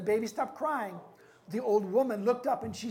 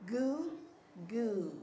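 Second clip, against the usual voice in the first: put-on drawn-out baby goo, rising then falling; baby's victory cry; 4 dB quieter than usual. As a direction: coo two notes like a baby. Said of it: "and the baby said".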